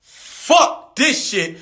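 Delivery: rising, pitch climbing high in panic; mock panic, escalating and then slowing; panicked cursing. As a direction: spiral into inner panic. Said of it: "I was like".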